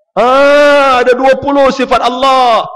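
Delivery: emphatic telling. Retold or imitated. Preacher telling his neighbour, loud and high. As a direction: drive the point home. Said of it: "Saya kata dalam Islam".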